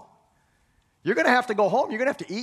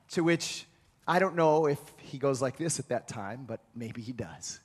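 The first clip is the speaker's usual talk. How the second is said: ordinary talk, nothing marked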